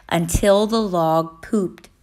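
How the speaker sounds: ordinary delivery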